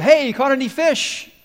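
shouted high and loud; calling out across water. Retold or imitated. Stranger on shore shouts to the fishermen, loud call. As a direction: hail the boat from the beach. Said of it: "this person yells out".

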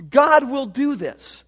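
slow, weighty God will do this; emphatic. Shown as a demonstration. Preacher assuring his congregation of the promise, emphatic. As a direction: press each word home slowly. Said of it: "It's an emphatic".

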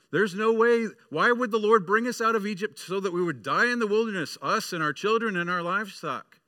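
high, loud whiny complaining; grumbling Israelites. Acted. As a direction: whine like hungry wanderers in the desert. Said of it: "And they grumbled again".

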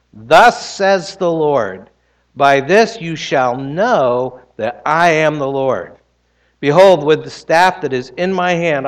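scripture reading. Acted. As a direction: read the Lord's words with weight. Reads Exodus aloud in sermon.

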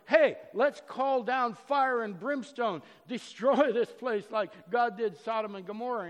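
outraged followers shouting for punishment; indignant disciples. Retold or imitated. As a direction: call down fire on the village. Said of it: "The disciples are indignant".

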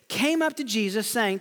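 ordinary talk

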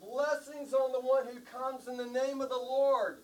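high, sustained proclaiming shout; leading a crowd chant. Shown as a demonstration. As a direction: shout the line out for others to echo. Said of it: "I want all of us to shout".

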